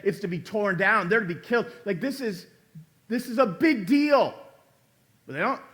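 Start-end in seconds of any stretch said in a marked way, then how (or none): none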